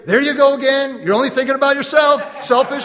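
loud, high mock nag to the husband; hammed-up wife complaining; mock scolding. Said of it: "Is she gonna say".

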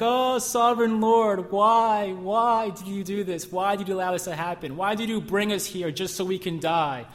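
crying out in despair. Retold or imitated. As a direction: wail it out like a hammed-up lament to God. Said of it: "he's crying all day".

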